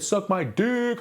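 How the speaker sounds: high, comic mock holler; too-hard joking delivery. Recalling what he told L R G, high mock holler. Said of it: "a little too hard".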